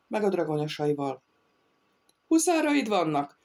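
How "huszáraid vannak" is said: shouting. A narrator's reading. The bandit Jónás shouts at the thief in disbelief. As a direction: hurl the question back in disbelief. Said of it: "kiáltotta a megkeresztelt Jónás".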